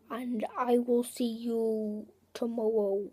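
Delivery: normal talking voice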